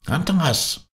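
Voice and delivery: high, falling call of a name. A narrator's reading. Doctor hails his companions across the icebergs, calling out. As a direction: hail a companion from a distance. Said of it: "erschollen die vier Zurufe".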